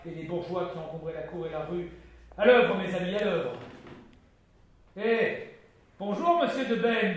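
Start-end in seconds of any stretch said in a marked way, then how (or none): 2.4-3.5 louder call to work; rallying call. Acted. Duke urging soldiers and townsfolk to the massacre, loud. 5.0-7.2 higher, louder hearty hello; admiring greeting. Acted. Coconas hailing Ben admiringly, high and loud.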